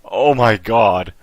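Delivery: loud, drawn-out swearing from a fright; real startled outburst. Real, not acted. Player jolted by a ringing phone, really startled and loud. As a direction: recover loudly from a jump scare. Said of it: "that fucking phone scared me".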